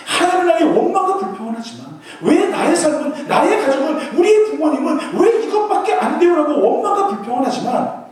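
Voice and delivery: loud, driving sermon delivery; forceful preaching. Acted. Preacher rebuking the youth, at full force. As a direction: preach it hard and high.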